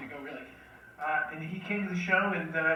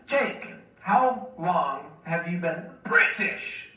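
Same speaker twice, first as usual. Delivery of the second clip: slow mocking question; 6 dB louder, much slower than usual. Teasing bandmate about his British act, slow drawn-out question. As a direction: drag the question out slowly, teasing.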